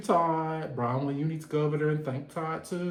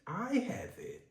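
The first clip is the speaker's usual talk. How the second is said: imagined Todd; 7 semitones higher than usual. Retold or imitated. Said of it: "I'm sure Todd is like".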